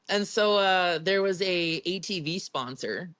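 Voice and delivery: normal talking voice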